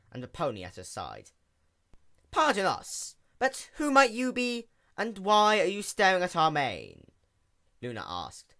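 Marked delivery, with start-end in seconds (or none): 2.3-7.1 lofty, raised princess voice with royal we; Luna's royal voice. A narrator's reading. Princess questions a staring sea pony in a lofty voice. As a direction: speak as a stately princess of the night.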